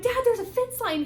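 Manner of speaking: high, loud call to dad; retold burst of excitement; excited sighting. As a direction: call it out with sudden hope.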